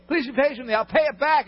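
high, desperate begging; pleading. Acted. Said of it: "The guy says".

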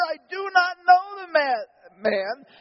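high, loud denial that breaks off; cursing denial. Acted. Peter swearing to the accusers, loud and high. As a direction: shout the denial, then cut short.

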